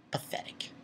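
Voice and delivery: whispered, barely voiced scorn; hushed, scornful whisper; contemptuous whisper. Real, not acted. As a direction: whisper it in disgust. Same word whispered, then drawn out.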